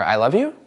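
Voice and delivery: YOU swept up at the end; rising question tone. Shown as a demonstration. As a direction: make "you" climb like a doubtful question. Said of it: "if it goes up".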